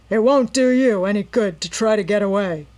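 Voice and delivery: loud rough villain voice; menacing captor. A narrator's reading. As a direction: warn her coldly. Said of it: "her captor informed her".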